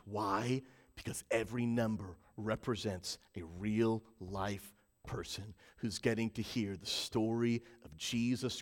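low, soft, sincere preaching; hushed, heartfelt pastoral tone; earnest reassurance. Real, not acted. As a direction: speak gently and personally to each listener. Earnest reassurance to online viewers.

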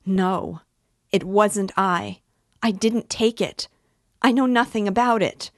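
desperate denial rising; Sonia's heartrending wail. Acted. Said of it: "she cried with a heartrending wail".

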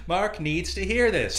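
slow, loud, high insistence; hammed-up echo of an outraged podcaster; mock indignant yell. Co-host backing the podcaster in the fight, indignant. Co-host joins the acted quarrel.

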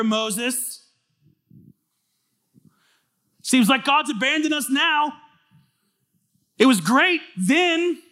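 high, loud whiny complaint of the crowd; mocking complaint. Israelites whining at Moses, high and loud. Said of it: "This is what they say".

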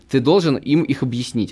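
ordinary talk, nothing marked

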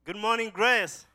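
loud, high, slow greeting to the room; calling out a greeting. Real, not acted. Speaker greeting the congregation from the front, loud and high. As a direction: ring it out to the whole church.

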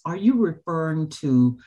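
normal talking voice

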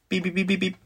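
high, soft electronic beeps; high beeping like a warning gadget; imitated beeping. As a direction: mimic a little proximity alarm. Imitates Bluetooth necklace alarm.

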